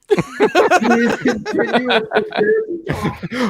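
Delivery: put-on slow, high German-accented voice; drawn-out German accent. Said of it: "I did that in my German voice".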